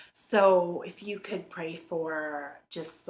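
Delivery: ordinary talk, nothing marked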